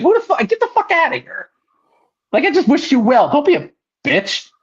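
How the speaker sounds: high, incredulous retort, retold; getting mad. Fan who wished the wrestler well, now mad and high-pitched. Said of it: "I was getting mad".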